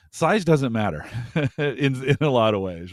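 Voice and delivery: loud, laughing through the words. Real, not acted. Co-host slips into innuendo about sponsors, laughing. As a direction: crack up at your own accidental innuendo.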